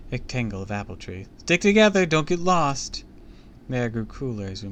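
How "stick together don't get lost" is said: high, loud call to the group; shouting. A narrator's reading. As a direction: call out to keep everyone close. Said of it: "I shouted".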